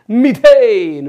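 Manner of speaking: Methane loud and high, falling at the end; punchy classroom call; shouted drill. Shown as a demonstration. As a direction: belt out methane to lead a chant. Chants alkane against alkyl name.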